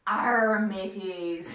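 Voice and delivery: loud growled pirate arr; big pirate call, falling away. Pretend. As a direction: hail the crew like a hearty pirate. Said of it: "what do pirates say?".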